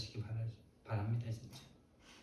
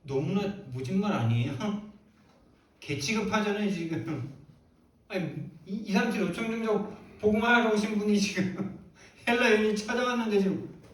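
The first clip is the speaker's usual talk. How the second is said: high, loud incredulous protest with a laugh; laughing indignation; 8 semitones higher, 6 dB louder than usual. Acted. Preacher to congregation about the harsh reply, laughing indignation. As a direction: protest in amused disbelief, pitch leaping up. Mock outrage at Jesus' harsh words.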